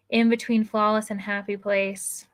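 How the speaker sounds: ordinary delivery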